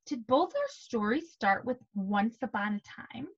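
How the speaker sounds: ordinary delivery, nothing marked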